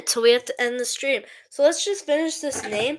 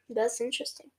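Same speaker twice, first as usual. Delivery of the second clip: normal talking voice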